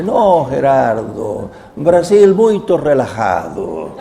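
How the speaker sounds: very slow, laid-back imitation; mock easygoing Brazilian interpreter; slow relaxed manner. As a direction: stretch every word, no hurry at all. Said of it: "Como que la cosa va despacio".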